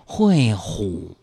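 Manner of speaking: very slow, low and soft; sloth slow. Shown as a demonstration. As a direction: drawl it out sleepily. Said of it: "刚才咱们这么说话".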